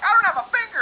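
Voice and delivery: high, loud indignant yell; outraged heckler. Retold or imitated. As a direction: imitate an offended woman shouting. Outraged audience member, before throwing glass.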